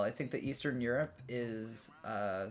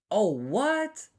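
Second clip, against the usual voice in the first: surprised; 9 semitones higher, 9 dB louder than usual. Real, not acted. Sudden chat reaction.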